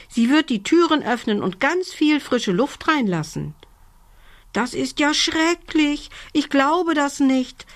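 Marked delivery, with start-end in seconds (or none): 4.5-7.7 put-on high-pitched young monster's dismay; high storybook voice, shocked and disbelieving; horrified disbelief. A narrator's reading. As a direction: protest in a high, appalled voice. Child monster hears frightening warning.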